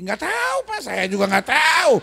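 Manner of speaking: high, loud, exasperated shout; mock shouting wife. A wife shouting that she doesn't know either, loud mock shout. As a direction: yell it back like a fed-up wife.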